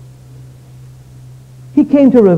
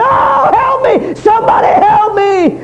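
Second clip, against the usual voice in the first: very high, drawn-out scream of agony; screaming for help; 4 dB louder, slower than usual. Retold or imitated. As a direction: scream slowly for rescue as if burning. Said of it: "He started screaming and crying".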